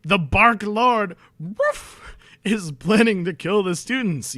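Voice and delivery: loud slow yell. Acted. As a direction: bellow it out slowly. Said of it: "yelled Cornelia Fudge".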